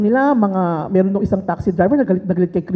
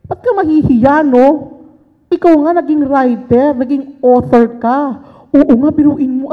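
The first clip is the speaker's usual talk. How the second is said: 1 excited hype; 3 semitones higher, 6 dB louder than usual. Retold or imitated. Friend hyping her up, retold.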